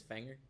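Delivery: FINGER said as "fanger"; mom's pronunciation. Retold or imitated. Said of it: "My mom doesn't say finger".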